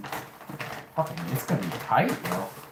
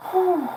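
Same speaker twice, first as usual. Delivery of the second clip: loud, high-pitched exhaled "whew"; 6 dB louder than usual. Real, not acted. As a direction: blow out a high whistly whew. Recovering from the shiver.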